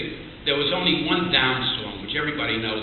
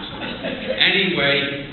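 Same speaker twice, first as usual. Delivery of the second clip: ordinary talk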